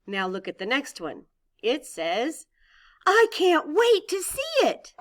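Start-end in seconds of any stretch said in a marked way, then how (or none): 3.1-4.9 high, loud, slow thrilled read; excited reading. Shown as a demonstration. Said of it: "difference in my voice".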